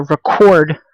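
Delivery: "record" stressed re-CORD, as the verb; stress on second syllable. Shown as a demonstration. Teacher reading an example about music, "record" stressed on CORD. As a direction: hit the last syllable of "record". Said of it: "putting the stress over here".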